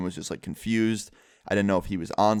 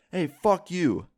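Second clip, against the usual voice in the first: mock yell; 8 semitones higher, slower than usual. Friend at the guy walking away, mock yell. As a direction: imitate a friend's yell at moderate volume. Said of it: "He screamed that at the guy".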